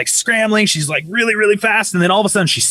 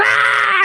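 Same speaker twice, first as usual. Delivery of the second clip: high mock bawling cry; imitated wail. Wife told to go home, imitated wail.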